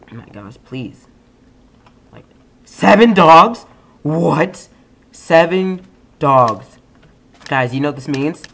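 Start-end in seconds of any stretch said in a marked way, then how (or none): 2.6-4.7 loud, slow, rising disbelief; surprised. Real, not acted. Surprised at the number. 5.1-6.8 slow, loud, falling flat statement; stunned statement. Real, not acted. Repeats in disbelief.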